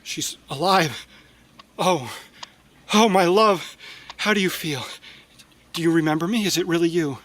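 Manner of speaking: tender, astonished lover; overjoyed disbelief. Acted. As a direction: rush of joy at her return.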